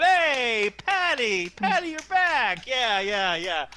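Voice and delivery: high, loud cheering from a crowd; voices ringing out. Acted. Crowd voices greet Patty's return, ringing cheer. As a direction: call out high and loud, easing off on the yeahs. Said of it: "a couple voices ring out".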